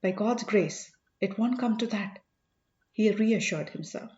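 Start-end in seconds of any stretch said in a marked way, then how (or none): none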